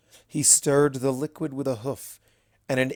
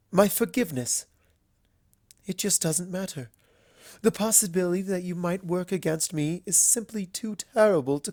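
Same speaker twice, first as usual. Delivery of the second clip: a slightly raised, earnest lady's voice; lightly voiced Rarity; 6 semitones higher than usual. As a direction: plead gently but firmly. Rarity pleading her case to Luna.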